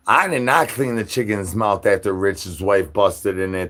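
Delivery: normal talking voice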